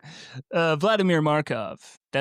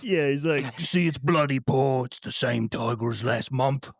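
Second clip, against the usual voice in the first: Tom Hardy impression. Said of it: "You know how Tom Hardy talks".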